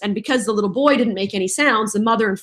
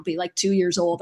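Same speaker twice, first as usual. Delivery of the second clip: ordinary talk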